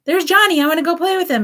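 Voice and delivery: put-on suddenly cheerful little kid spotting a friend; excited kid. Child forgetting the fall on seeing Johnny, high excited voice. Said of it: "the kid's like".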